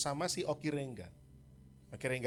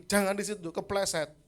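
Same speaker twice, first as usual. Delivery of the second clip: higher, louder repeated warning; 6 semitones higher, 5 dB louder than usual. Retold or imitated. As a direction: warn him again, more insistent. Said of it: "saya bilang lagi".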